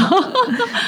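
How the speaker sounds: a brief giggle up high; short high laugh. Real, not acted. Host giggling at her own joke about ordinary people, a short high laugh.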